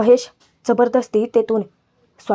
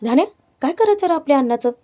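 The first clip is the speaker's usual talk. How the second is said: higher, quick baffled question; retold astonishment; 4 semitones higher, faster than usual. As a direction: act out being taken aback, pitch leaping up. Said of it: "आश्चर्यचकित होतो की".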